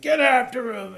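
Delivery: imitating the villain yelling an order; mock shouted order. Podcaster quoting the villain, mock shouted order.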